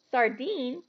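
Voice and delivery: ordinary delivery, nothing marked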